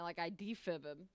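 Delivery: ordinary talk, nothing marked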